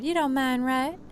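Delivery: ordinary talk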